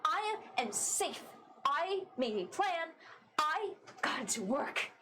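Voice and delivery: runaway telling her crew she can protect herself; defiant Astra. Acted. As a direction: throw their warnings back at them.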